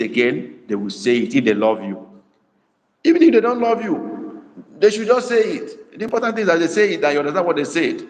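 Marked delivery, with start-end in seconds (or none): 3.0-7.0 raised emphatic insistence; emphatic burst. Real, not acted. Emphatic teaching point.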